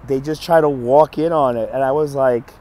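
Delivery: ordinary delivery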